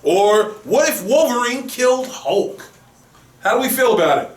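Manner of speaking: normal talking voice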